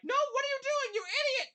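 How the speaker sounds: high, loud desperate scolding; panicked shout at a reckless friend; frantic yell. Acted. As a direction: yell it through the glass at the fool. NPC banging on the tank glass.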